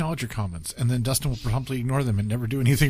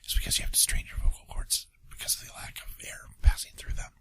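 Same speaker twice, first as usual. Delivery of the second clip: whispering; 7 dB quieter than usual. Pretend. Whispering in the game.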